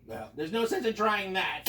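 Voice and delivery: ordinary delivery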